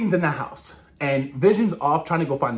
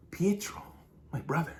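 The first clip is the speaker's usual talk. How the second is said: half-whispered, halting and very soft; imitated quiet reverie; hushed mournful quote; 11 dB quieter than usual. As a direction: breathe it out softly, pausing midway. Quoting Wanda recalling her brother.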